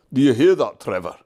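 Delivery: loud, glad call across the yard; cried out. Acted. Jem calling the news to Trevor, loud and glad. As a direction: call the good news out to him. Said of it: "cried Jem".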